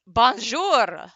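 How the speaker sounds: hammed-up French accent; big, playful French accent. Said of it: "Use the French accent".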